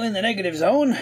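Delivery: normal talking voice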